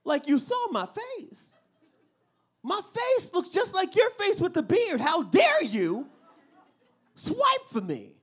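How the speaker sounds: high, loud outraged scolding; outraged son. Pretend. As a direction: berate her in disbelief.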